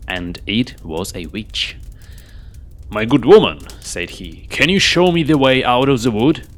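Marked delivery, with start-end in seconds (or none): 2.9-3.6 louder, commanding royal voice; king calling out. Acted. Lost king hailing the old woman, loud and commanding. 4.5-6.4 louder, higher, urgent royal question; king asking. Acted. Lost king asking the witch the way out, urgent.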